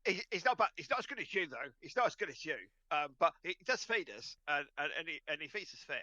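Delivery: blissed-out talking dog; flustered happy dog. Acted. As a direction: stammer an answer while being scratched. Dog answers, flustered by scratching.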